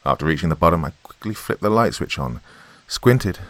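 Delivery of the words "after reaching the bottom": plain reading voice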